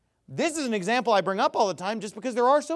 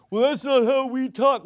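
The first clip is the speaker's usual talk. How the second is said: raised, louder fake Aussie voice; mock Australian accent; 4 semitones higher, 5 dB louder than usual. Preacher mimicking the Australian critic, mocking accent. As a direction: mimic an Australian objector badly. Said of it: "You're from Australia".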